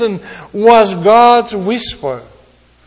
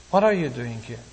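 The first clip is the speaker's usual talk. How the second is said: much softer and lower, almost hushed; very softly; 8 semitones lower, 9 dB quieter, faster than usual. Shown as a demonstration. Preacher voicing God's question to the congregation, very softly. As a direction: ask it gently, barely above a breath. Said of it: "Very softly".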